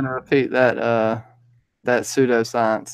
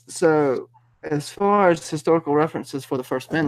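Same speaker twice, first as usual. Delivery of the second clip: put-on light Valley Girl lilt, higher; breezy Valley Girl accent on request; 4 semitones higher than usual. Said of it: "Valley Girl accent? Do it".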